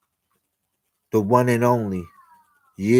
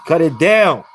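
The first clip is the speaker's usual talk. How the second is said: call out; 6 semitones higher, 5 dB louder than usual. Real, not acted. Calling out to turn it down.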